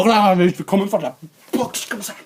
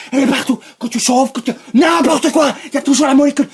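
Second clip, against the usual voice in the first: loud, high, insistent outburst; mounting rant; 6 semitones higher, 5 dB louder, faster than usual. Pretend. Warning that the molecule is everywhere, loud and high. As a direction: push louder and higher with each phrase. Ranting rise about the molecule.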